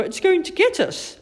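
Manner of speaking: high, loud warning; alarmed call. A narrator's reading. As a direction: cry out as danger closes in. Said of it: "called Kipper".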